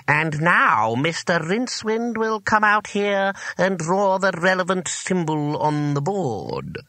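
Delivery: high, slow drone of a dreaded teacher; slow, droning classroom voice; droning schoolmaster. A narrator's reading. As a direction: drag it out as a droning teacher summoning a pupil. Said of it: "the voice had been droning on".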